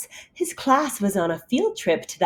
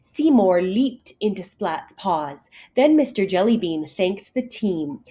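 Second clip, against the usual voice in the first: ordinary delivery, nothing marked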